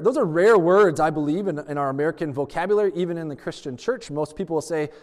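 ordinary delivery

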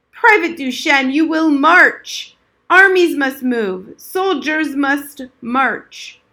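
loud, slow barked orders; drill commands. Acted. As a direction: drill sergeant ordering a march. Old soldier barks orders.

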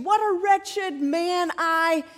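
slow, high, drawn-out lament; weighty self-reproach at half speed; voice of condemnation. Acted. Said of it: "That's the voice of condemnation".

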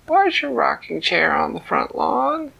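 put-on high, small child voice; a little cub asking mama; baby bear voice. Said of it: "Baby Bear said".

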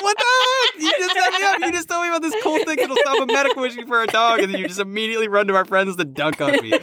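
high, fast laughing protest; exasperated, laughing disbelief; mock outrage. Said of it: "And I'm like".

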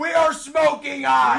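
excited, ringing holler; hype announcer shout. Real, not acted. Streamer celebrating a Joey B pull, hyped shout. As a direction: crow about the hot streak.

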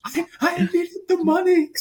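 very high, loud mock-hysterical plea; imitating helpless laughter. Poisoned man confessing to Batman, helpless laughing. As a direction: force the words out through put-on hysterics. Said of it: "laughing. He can't stop".

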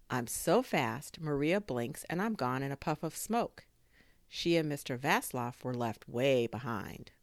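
usual speaking voice

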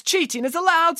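very high, emphatic official's voice; CHEATING IS ALLOWED pitched high, as a ruling; insistent vice principal. A narrator's reading. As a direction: insist on it as an indignant school official.

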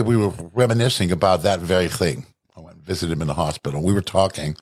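talking around a cough drop; words through a lozenge; chewing while talking. Real, not acted. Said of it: "I'm chewing on a cough drop".

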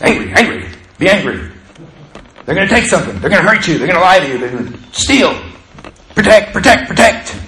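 a growling inner guard dog, pushing harder; barking dog voice. Acted. The barking dog in their head, goading them. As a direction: bark out the warnings like a guard dog. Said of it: "the barking dog in their head says".

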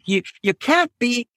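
ordinary talk, nothing marked

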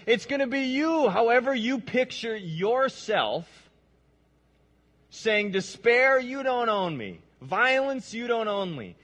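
loud, high, declaiming each defiance; full-force preaching. Acted. Preacher models the congregation's defiant words, loud. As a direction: hurl each word at despair.

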